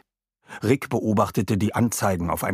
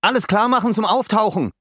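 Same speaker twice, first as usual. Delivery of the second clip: high, loud captain's command; called out; 12 semitones higher, 5 dB louder than usual. A narrator's reading. Rick orders the submarine crew to get ready to surface, called out. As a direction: call it across the cabin, pitched way up. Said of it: "rief Rick Nautilus".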